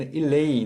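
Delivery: इल्लई हर अक्षर पर ज़ोर, खिंचा हुआ इ; stress on illai. Shown as a demonstration. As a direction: pronounce Illai with a firm push. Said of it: "जोर डाल देना है". (इल्लई पर ज़ोर देकर)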